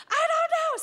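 high, loud laughing squeal; laugh-squeal in fake panic; me answering mom in high panic; mock panic. Both freaking out, retold.

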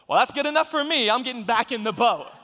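loud, high-pitched comic exclamation; hasty bail-out. Acted. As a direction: call it out, eager to quit. Acts out a scared-off water walker.

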